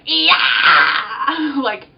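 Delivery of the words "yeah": loud, drawn-out screamed "yeah"; excited scream. Retold or imitated. Answering roommate about vines, excited scream. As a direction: burst into an over-the-top excited shriek. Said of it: "as I was so excited".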